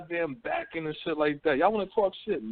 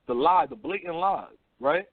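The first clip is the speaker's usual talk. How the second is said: ordinary talk, nothing marked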